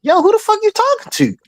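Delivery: sharp high-pitched challenge; confrontational challenge. Retold or imitated. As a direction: square up to the disrespect. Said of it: "I'm like".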